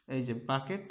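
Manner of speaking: normal talking voice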